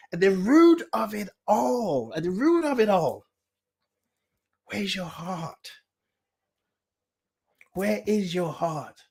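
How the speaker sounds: intimate preacher's hush. Real, not acted. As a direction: ask it gently to the heart. Sermon-style point about the heart.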